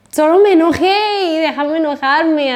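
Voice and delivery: half-hammed angry protest; mock huffy retort. Daughter snapping back at her mother, mock huffy. Retold angry reply to mom.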